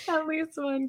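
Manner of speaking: cracking up mid-phrase; breaking into laughter; laughing. Real, not acted. Repeating joke, cracking up.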